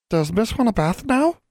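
very high little voice; eager servant creature. A narrator's reading. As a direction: squeak out a helpful offer. Servant creature speaks in story.

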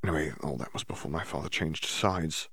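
hushed. Acted. Draco telling Hermione about his father, hushed. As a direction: keep it down so others don't hear. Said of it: "his voice hushed".

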